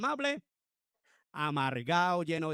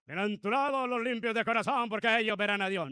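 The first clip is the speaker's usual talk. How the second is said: the beatitude barked out louder and higher; harsh ill-tempered preaching; 5 semitones higher, 4 dB louder than usual. Shown as a demonstration. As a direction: deliver the blessing like a grumpy, bad-tempered preacher. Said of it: "Imaginémonos dando las bienaventuranzas". (bienaventuranzas dichas con mal genio, más fuerte y aguda)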